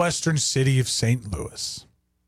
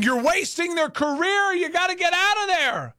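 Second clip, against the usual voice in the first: higher, urgent mock fan outcry; mock football fan yelling; 9 semitones higher than usual. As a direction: plead like an outraged fan. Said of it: "there would be yelling and screaming".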